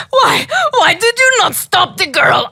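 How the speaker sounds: high, loud witchy demand; witch voice. Acted. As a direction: screech it like Baba Yaga. Baba Yaga voiced in story.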